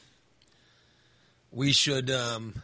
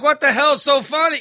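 higher, louder annoyed demand; mock outburst; 10 semitones higher, 8 dB louder than usual. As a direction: bark it at the giggling bedroom. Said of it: "I'm like".

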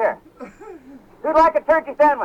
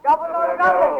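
higher turkey gobble chant; gobbling turkey voice; 4 semitones higher than usual. Acted. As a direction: lead the crowd in gobbling. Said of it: "time to start the turkey chant".

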